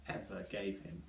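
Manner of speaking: ordinary delivery, nothing marked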